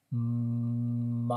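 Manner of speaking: MOP stretched slowly as one unbroken word at the end; continuous but elongated. Shown as a demonstration. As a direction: ask it, drawing out MOP with long m and ah. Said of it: "Continuous but elongated".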